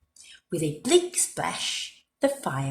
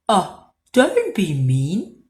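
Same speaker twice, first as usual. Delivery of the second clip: a louder, sniffly wolf plea; sniffy wolf; 6 dB louder, slower than usual. A narrator's reading. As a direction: sniff through a stuffy nose. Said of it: "sniffed Big Bad".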